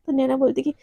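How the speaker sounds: ordinary delivery, nothing marked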